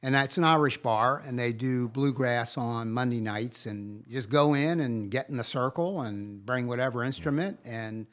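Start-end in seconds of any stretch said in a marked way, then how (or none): none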